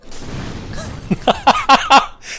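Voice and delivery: high airless evil cackle. Real, not acted. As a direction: cackle with wicked glee at the kill. Gleeful kill of Lautrec.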